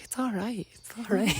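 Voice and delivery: reassuring. Retold or imitated. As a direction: gently settle the crying family. Comforting crying visiting relatives.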